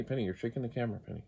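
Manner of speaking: ordinary talk, nothing marked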